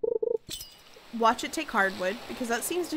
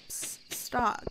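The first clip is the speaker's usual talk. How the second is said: whisper. Real, not acted. Calling someone in a hush.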